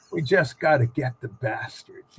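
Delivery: retelling her line a second time, still low and dry; repeated deadpan quote. Retold or imitated. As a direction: say it low again, dry and blunt. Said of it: "And she goes".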